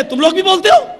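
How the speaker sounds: officer loudly questioning the complainants; incredulous demand. Retold or imitated. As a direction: challenge them, startled and loud.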